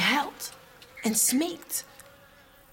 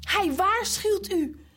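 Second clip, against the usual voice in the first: whining servant; 8 semitones higher, slower than usual. Acted. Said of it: "jammert de bediende".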